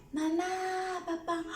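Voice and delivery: high-pitched call to both parents; child hollering for parents. Shown as a demonstration. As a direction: summon them with long, high vowels. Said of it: "tú estás llamándolos".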